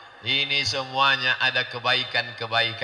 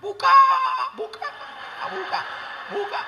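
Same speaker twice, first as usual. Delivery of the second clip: 1 high calls. Acted. Calling to open the door.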